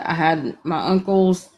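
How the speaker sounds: ordinary talk, nothing marked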